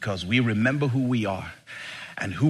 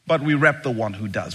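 normal talking voice

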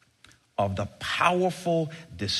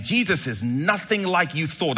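normal talking voice

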